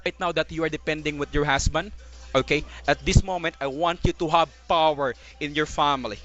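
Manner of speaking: ordinary delivery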